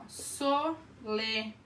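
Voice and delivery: Solenidade drawn out syllable by syllable; slow teaching demo of the syllables. Shown as a demonstration. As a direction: stretch Solenidade so each vowel is heard. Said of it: "toda sílaba tem pelo menos uma vogal".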